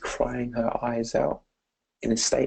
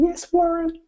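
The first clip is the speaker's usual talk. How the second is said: put-on a high little girl's answer. The girl answers her coach Warren in a high child's voice.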